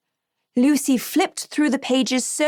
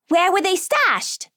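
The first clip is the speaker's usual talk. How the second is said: put-on eager child; 8 semitones higher than usual. Lucy eager, high child voice.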